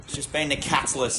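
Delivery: usual speaking voice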